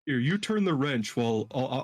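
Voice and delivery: ordinary delivery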